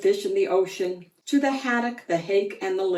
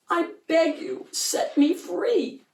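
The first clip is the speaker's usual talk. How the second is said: begging; 9 semitones higher than usual. A narrator's reading. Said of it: "again she said".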